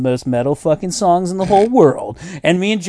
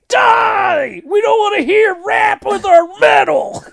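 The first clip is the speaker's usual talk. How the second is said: high, furious mock crowd yell; loud, high-pitched protest shout; yelled heckle; 4 dB louder, slower than usual. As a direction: roar it like an outraged metalhead. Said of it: "yelled out a couple of inappropriate things".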